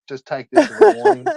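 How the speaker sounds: high, loud mocking imitation of the inspector. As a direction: parody the official, squeaky and loud.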